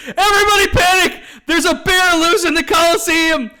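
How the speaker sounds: high, loud panicked yelling; mock panicked shout. Retelling Jackie shouting at the panicking crowd, mock shout. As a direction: shout it, running in circles. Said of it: "starts shouting".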